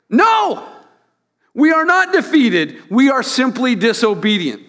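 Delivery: high, loud, forceful declaration; emphatic protest. Acted. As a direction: thunder it out like a preacher refuting a claim. Emphatic reading of preacher's quote.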